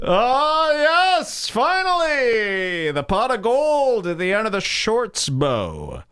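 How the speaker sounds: high, drawn-out creepy rapture; hammed-up, stretched-out delight; mock ecstasy. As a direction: swoon with overdone relief, sliding down from high. Imagined creep reaching his goal.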